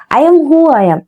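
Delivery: swaggering attitude. Retold or imitated. A young woman before motherhood, boasting with swagger. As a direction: toss it off like a proud youngster. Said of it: "இப்படி கெத் ஆட்டிடியூடா இருப்பாங்க".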